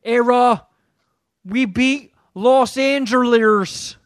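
drawn-out mock Boston accent boast. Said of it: "they're like".

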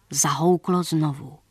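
ordinary delivery

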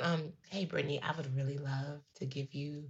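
leaning in to whisper. Acted. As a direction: lean in, whisper back. Playful hushed refusal to spoil.

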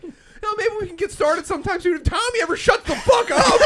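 high-pitched exasperated rant at a teammate; building to a high, loud outburst; mock berating. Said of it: "Gerben was berating Tommy".

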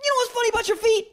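very high comic voice; mock bit pitch. Acted bit pitched to his mom.

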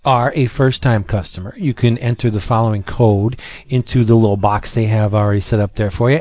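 hard sell ad. Acted. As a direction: sell it like a radio ad. Sponsor ad read.

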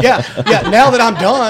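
loud, high mock exasperation; retold indignant comeback; exasperated retort. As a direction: throw it back loudly through the laughter. Sarcastic retold answer to late help.

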